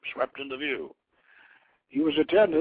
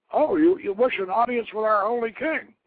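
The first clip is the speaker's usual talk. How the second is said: higher, louder startled official; surprised magistrate; 9 semitones higher, 6 dB louder than usual. Acted. Magistrate to the pilgrim, caught off guard and surprised. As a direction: blurt it out, taken aback.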